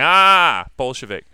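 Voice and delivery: imitating Tyler's snickering Ah before Bolshevik; imitating student. Teacher mimicking a student who snickered at Bolshevik. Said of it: "can you hear his voice?".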